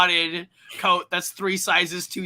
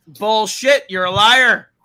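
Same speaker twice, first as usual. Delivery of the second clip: loud, raised-pitch retort; combative, high, loud comeback; heated accusation; 5 semitones higher, 10 dB louder, slower than usual. Real, not acted. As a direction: call them out at full volume. Host hotly disputes co-host's claim.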